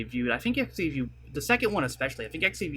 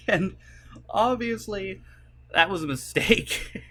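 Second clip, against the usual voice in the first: laughing through the admission, higher pitched; amused admission; 6 semitones higher, 3 dB louder, slower than usual. Real, not acted. YouTuber owning up to mistaking tea for juice, laughing. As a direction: own up through real laughter.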